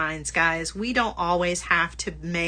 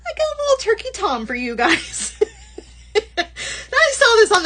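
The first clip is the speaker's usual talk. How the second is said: laughing through the words, pitched up high; 14 semitones higher, 5 dB louder than usual. Real, not acted. Host laughing while presenting a turkey charm to viewers. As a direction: giggle as you show off the charm.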